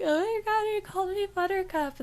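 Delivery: high, giddy swoon, barely above her own voice. Retold or imitated. Recalling the cute Subway regular, giddy high swoon. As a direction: gush like a smitten teen. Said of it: "my heart would melt".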